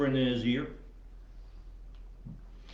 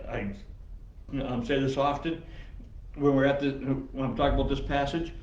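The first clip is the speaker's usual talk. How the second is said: usual speaking voice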